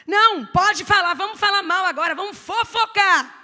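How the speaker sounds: loud, high hammed-up gossip invitation; mock gossip urging. Preacher loudly mocks gossips urging each other on. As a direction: egg them on to gossip.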